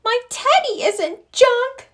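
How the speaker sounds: put-on high, slow, childlike protest; child's scream. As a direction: scream it like a little cub losing her teddy. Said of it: "screamed Sister".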